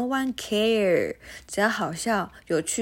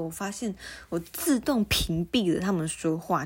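usual speaking voice

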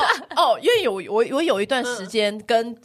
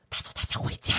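hushed whisper with the phone covered; 8 dB quieter than usual. Retold or imitated. Reporter whispering to colleagues, hand over the phone, hushed. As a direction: whisper it so the caller can't hear.

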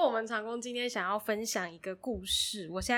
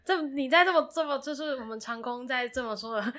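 usual speaking voice

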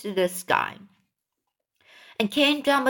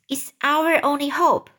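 ordinary delivery

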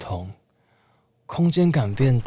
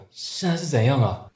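ordinary talk

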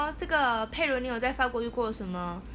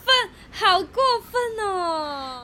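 mock crying; 8 semitones higher, 5 dB louder, slower than usual. Mock upset reaction to rude clerk.

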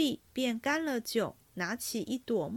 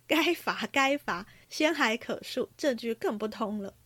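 ordinary delivery

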